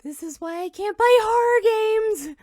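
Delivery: high, loud, rattled outburst; shaken shout. Real, not acted. After the jumpscare, loud rattled complaint. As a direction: yell it out, still shaking from fright.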